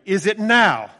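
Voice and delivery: loud frightened demand; alarmed demon. Acted. Demon asking Jesus in a loud alarmed voice. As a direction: blurt it out in alarm.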